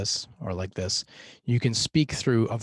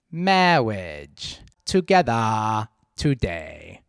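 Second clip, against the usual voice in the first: Peter Cook pitch; 5 dB louder, much slower than usual. Shown as a demonstration. Coach shows the priest's rising and falling pitch. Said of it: "I'll move my hand up and down to indicate when the pitch".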